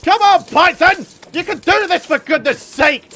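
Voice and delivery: high loud rallying yell; frantic hype; loud urging. Real, not acted. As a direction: shout yourself on to the finish. Boss fight final moments.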